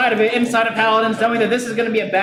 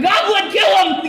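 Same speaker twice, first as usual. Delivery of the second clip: higher, louder, drawn-out command to attack; battle-cry shout; 9 semitones higher, 3 dB louder, slower than usual. Acted. Halfling ordering his companion to kill the goblin, shouting. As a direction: bellow the order to charge.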